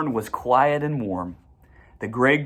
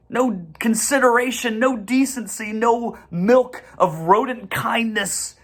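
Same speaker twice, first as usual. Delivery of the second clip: higher, rolling list of faults; building list; 10 semitones higher than usual. A narrator's reading. Narrator lists the rat's failings with rising zeal. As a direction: pile up the faults with relish.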